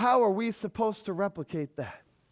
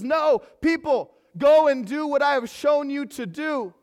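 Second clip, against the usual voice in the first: higher, louder, slow urging; emphatic command; 7 semitones higher, 7 dB louder than usual. Retold or imitated. Jesus telling his people to act, emphatic command. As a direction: press the charge home.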